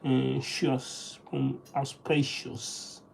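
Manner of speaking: ordinary delivery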